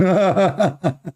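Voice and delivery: laugh. Real, not acted. Laugh after a retort.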